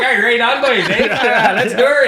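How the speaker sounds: cheery rural Canadian pal, laughing; hammed-up Kelly Taylor. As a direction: overdo the hoser buddy greeting. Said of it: "They'd all go back to their normal voice".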